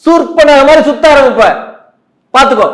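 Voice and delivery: high, loud angry rant; angry shouting. Acted. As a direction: shout an irritated warning. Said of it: "எதுக்கு கத்துறீங்க?".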